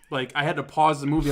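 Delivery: normal talking voice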